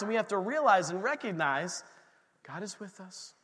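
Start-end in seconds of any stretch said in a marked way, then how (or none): none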